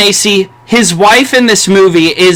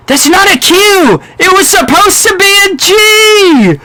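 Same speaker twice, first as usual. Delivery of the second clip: mock frantic yell; 11 semitones higher than usual. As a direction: squeal the complaint like the flustered character. Quoting Luis Guzman's film line.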